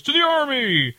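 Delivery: high hammed-up toast call; mock crowd yell. Retelling the crowd toast, mock yell. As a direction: call it out like a room full of sailors. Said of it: "everyone yells".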